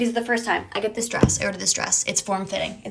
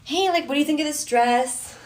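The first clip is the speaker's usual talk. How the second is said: higher-pitched, fishing for a compliment; cutesy asking; 6 semitones higher than usual. Retold or imitated. Girlfriend filming a dress video for him, cutesy high voice. Acts herself posing in the video.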